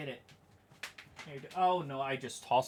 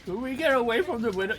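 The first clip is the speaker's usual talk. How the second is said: loud, raised mock of a worried question; mocking echo; 7 semitones higher, 7 dB louder than usual. Streamer parroting a tornado clip, loud and mocking. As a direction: throw the question back loud and high.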